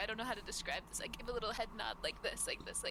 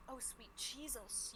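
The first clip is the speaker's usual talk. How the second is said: awed mouse; 2 semitones higher, 9 dB quieter, much slower than usual. Acted. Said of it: "I'm like".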